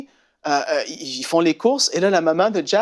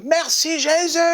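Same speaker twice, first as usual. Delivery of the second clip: much higher praise cry; joyful shout; 12 semitones higher, slower than usual. Retold or imitated. Young man at the revival praising Jesus, much higher. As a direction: cry out thanks. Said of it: "il crie".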